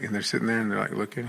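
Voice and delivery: ordinary talk, nothing marked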